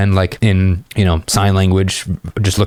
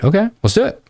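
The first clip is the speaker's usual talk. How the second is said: much higher, bouncy okay; upbeat agreement; 12 semitones higher than usual. Acted. Guy telling the girl they're heading out, eager and higher. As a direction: jump at the yes.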